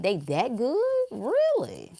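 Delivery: high, drawn-out disbelief; incredulous. Real, not acted. Asking the audience if the powerful are that good. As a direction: pitch it up in disbelief.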